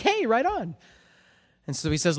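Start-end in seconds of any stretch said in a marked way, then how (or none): 0.0-0.8 cheerful hey. Retold or imitated. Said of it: "We're like".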